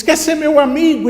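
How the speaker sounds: high, loud, smiling question; happy shouted call. Acted. Smiling boy offers friendship to the hidden child, shouting. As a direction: holler a friendly offer across the valley. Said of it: "ele abre um sorriso e diz".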